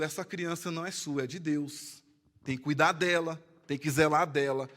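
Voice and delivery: hushed solemn quote; soft quote. Retold or imitated. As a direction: say it low and gently, like a blessing. Quoting pastor at child dedication.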